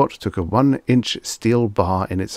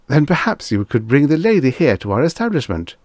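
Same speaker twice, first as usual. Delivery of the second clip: higher, louder anxious clerk; nervous salesman; 7 semitones higher, 5 dB louder, faster than usual. Acted. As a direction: coax him politely. Clerk's careful suggestion.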